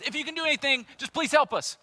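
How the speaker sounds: high, loud pleading father; desperate plea. Acted. Preacher voicing father begging Jesus again, high desperate plea. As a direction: cry out to Jesus for your son.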